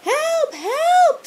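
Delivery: very high cries for help; girl screaming for help; screamed. A narrator's reading. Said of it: "screamed Ruby".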